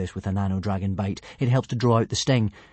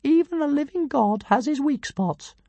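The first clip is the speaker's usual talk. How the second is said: pompous tiny dragon. A narrator's reading. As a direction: announce yourself like a pint-sized deity.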